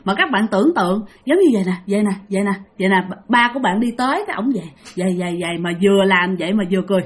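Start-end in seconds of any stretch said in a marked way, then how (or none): none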